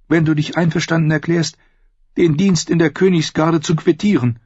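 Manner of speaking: high woman's voice, insistent; urging sister. A narrator's reading. Sister urges brother to quit the Kingsguard, insistent. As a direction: coax him in a high female voice.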